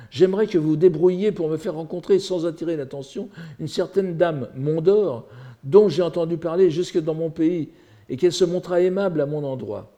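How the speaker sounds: declaimed literary dialogue; quoted request read aloud. Acted. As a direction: read out a character's formal request.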